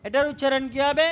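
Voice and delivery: usual speaking voice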